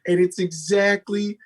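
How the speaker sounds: incredulous. Real, not acted. Incredulous rant.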